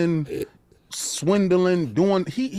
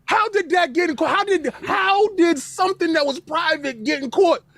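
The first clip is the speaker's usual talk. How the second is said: laughing outcry; 10 semitones higher, 3 dB louder than usual. Real, not acted. Host cracking up, shouting question.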